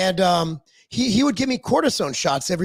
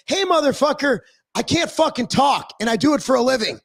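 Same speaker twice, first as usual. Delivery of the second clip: high-pitched retold protest; worked-up, swearing appeal to the doctor; exasperated demand; 6 semitones higher than usual. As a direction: plead your case, fed up. Retells pleading with doctor over voice.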